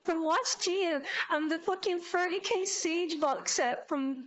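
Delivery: normal talking voice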